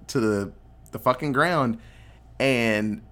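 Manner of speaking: normal talking voice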